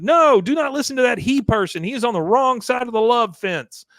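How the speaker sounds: a cult leader calling the women back, high. Retold or imitated. Cult leader warning Peggy away from Hank, high and loud. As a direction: pitch it high, preachy and insistent. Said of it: "cult leader behind her says".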